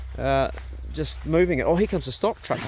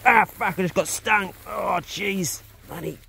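sudden pain yelp; 5 semitones higher, slower than usual. Real, not acted. Stung by a bee while filming, a real pained outburst. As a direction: blurt it out as the bee stings. Bee stings him mid-sentence.

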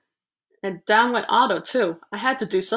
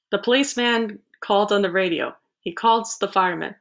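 ordinary delivery, nothing marked

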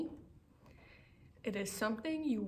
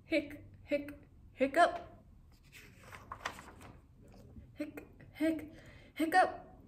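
hiccups; 6 semitones higher than usual. Acted. Clifford hiccups in story.

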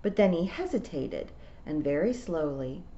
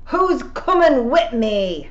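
battle cry; 7 semitones higher, 10 dB louder, slower than usual. A narrator's reading. Rob raising sword, rallying.

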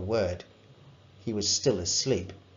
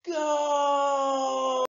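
high, long triumphant roar; cheering goal shout. A narrator's reading. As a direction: yell it like the whole stadium.

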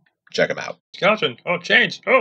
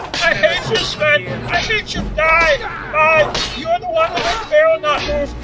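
much higher, louder mock screaming at Sven; hammed-up fake rage; 12 semitones higher, 4 dB louder than usual. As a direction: scream hate at the modder, overacted.

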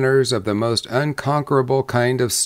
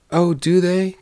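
mild, higher-pitched exclamation of surprise; a touch of wonder, pitched up; surprised cry; 7 semitones higher than usual. Acted. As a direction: exclaim lightly as the astonished bride. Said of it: "cried the bride".

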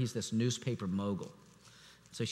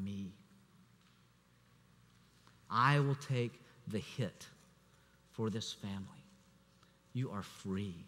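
tender father; 5 dB quieter than usual. Retold or imitated. Father tells weeping daughter he takes the blame, softly. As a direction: speak like a father lifting the weight off his daughter.

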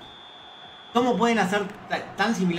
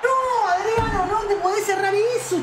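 frustrated yell; 12 semitones higher, slower than usual. Real, not acted. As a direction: yell at the player who missed. Delantero falla el gol. (grito de frustración)

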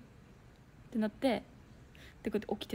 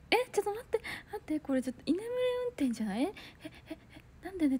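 startled alarm; 7 semitones higher, faster than usual. Retold or imitated. Noticing the driver dozing off.

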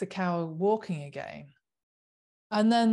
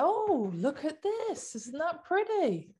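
mock gushing buyer; 8 semitones higher than usual. Mimicking a shopper charmed by leather in tissue paper, mock gushing. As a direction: coo over the gift box in mockery. Mocking a buyer admiring packaging.

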